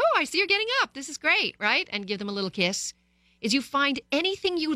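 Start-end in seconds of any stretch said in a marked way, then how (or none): 0.0-1.5 put-on high, bright-eyed praise for the kid; overjoyed parent praise; cheery praise. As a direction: gush over the kid getting up.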